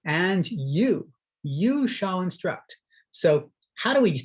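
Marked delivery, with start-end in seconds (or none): 0.1-2.8 "you" rising, then slowed and leaned on; slow down and stress "you"; teacher stressing "you" for students; emphasis on you. Shown as a demonstration. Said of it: "But we add here".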